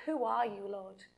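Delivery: low and soft, falling away; a touch of awe, barely daring to ask; hushed awed question. Acted. Paul answering the voice from heaven, low and hushed.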